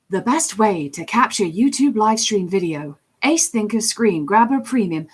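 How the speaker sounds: measured announcer-style ad read; commercial voice. Shown as a demonstration. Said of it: "a commercial voice that I do".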